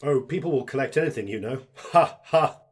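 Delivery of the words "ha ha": booming Ha ha; loud hearty laugh. Acted. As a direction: laugh it out big. Laughs loudly.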